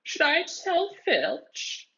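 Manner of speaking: very high, slow gloating ghost voice; squeaky mischievous poltergeist threat; teasing poltergeist. A narrator's reading. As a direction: dangle the threat like a sly spirit. Peeves threatening to tell.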